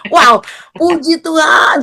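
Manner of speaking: high, drawn-out joyful exclamation; slow, jubilant whoop of thanks; elated praise. Retold or imitated. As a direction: cry it out in delighted amazement. Reenacts her delight at father's words.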